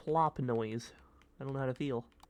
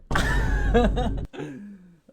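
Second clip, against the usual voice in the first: high, loud burst of laughter; 9 semitones higher, 12 dB louder than usual. Real, not acted. Streamer laughing at own joke, loud and high. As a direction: crack up at your own joke.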